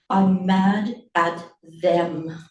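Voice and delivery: slow, weighted declaration; firm, deliberate anger; simmering anger. Acted. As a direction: stretch each word with controlled fury. Mother's anger turns on others.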